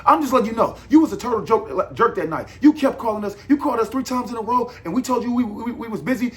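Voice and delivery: high, loud mocking imitation of the manager; irritated manager lady brushing off a complaint, mocked high and loud; mimicking the manager lady. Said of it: "I said".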